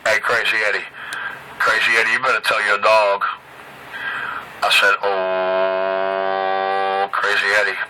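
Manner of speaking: deep taunting singsong; low mocking voice. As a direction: drop low and mock him. Low mocking chant at rival.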